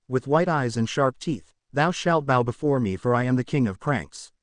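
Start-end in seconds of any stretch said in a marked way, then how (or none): none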